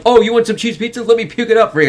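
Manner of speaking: high-pitched teasing imitation; mock taunting. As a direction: taunt him like a bratty older brother. Acting out the brother's taunt.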